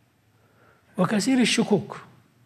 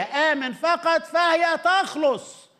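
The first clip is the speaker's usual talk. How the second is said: slow declaration; 10 semitones higher, much slower than usual. Shown as a demonstration. Preaching the verse slowly.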